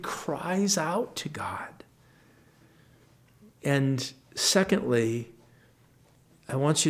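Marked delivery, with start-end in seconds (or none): 0.0-1.8 sudden hushed whisper. Acted. Pastor whispering David's cry to his listeners. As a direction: drop the voice to a breath for God.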